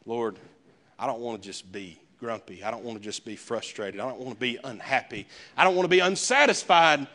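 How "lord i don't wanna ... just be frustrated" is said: ordinary delivery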